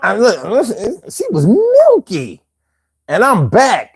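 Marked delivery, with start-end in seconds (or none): 1.1-2.4 high slow punchline. Real, not acted. Third take, high and slow.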